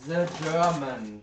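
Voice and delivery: loud, drawn-out German accent; proper German accent. Shown as a demonstration. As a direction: demonstrate a heavy German accent, slowly. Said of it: "accent, it should be".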